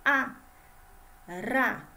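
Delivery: A and RA separated by a pause, loud; isolated syllable sounds. Shown as a demonstration. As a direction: punch "A", then "ra", one at a time. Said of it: "Olha o som aí". ("A", pausa, "ra", sílabas fortes)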